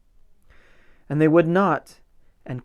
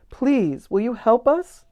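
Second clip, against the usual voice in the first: butterflies asking; 8 semitones higher, 3 dB louder than usual. Acted. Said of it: "the butterflies asked".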